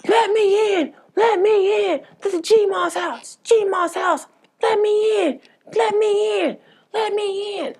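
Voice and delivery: very high, loud screech; screaming. Retold or imitated. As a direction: scream to be let in, top of your lungs. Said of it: "screaming at the top of his lungs".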